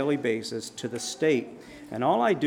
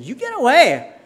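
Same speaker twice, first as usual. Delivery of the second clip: loud, high yell to drive him off; shout at the dog; 11 semitones higher, 12 dB louder than usual. Retold or imitated. Man yelling at a snarling German Shepherd to back off, loud. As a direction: bark the order at him.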